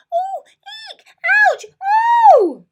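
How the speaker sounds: high, loud yelps while falling. A narrator's reading. Mole slips from the tree, yelping high and loud. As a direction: yelp as you slip and tumble.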